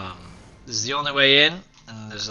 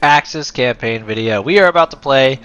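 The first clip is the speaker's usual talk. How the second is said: energetic intro; 3 semitones higher, 10 dB louder than usual. Acted. YouTuber greeting viewers to his campaign video, loud upbeat intro. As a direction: pump up the channel welcome.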